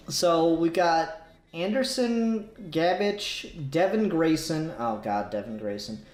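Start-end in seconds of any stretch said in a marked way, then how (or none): none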